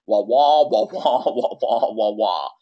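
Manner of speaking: muted trombone wah-wah noises; Charlie Brown's teacher. Retold or imitated. Coach mimicking what clients hear, droning wah wah. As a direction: imitate a droning muffled trumpet teacher. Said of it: "It's like Charlie Brown's teacher".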